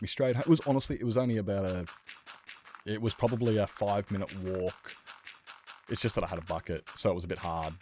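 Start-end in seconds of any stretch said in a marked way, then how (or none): none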